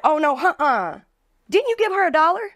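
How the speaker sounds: high-pitched, offended sass; mock indignant customer. Angry customer confronting the bartender over a dollar, high mock outrage. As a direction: play the outraged girl calling her out. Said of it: "she's like".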